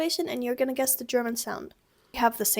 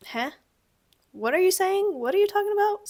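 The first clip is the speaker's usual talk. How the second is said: high, louder baffled questions; baffled Heh; 7 semitones higher, 4 dB louder than usual. Shown as a demonstration. Teacher reacts to purple trees, baffled. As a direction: react as if this is nonsense. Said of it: "a very good response would be".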